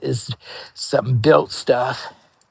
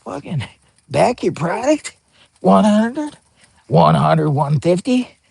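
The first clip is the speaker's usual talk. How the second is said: excited disbelief; 5 semitones higher than usual. Retold or imitated. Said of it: "I was like".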